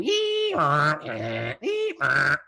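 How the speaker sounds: high electronic screech of tape data; imitating cassette data screech. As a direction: squeal like a loading data cassette. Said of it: "it'd go".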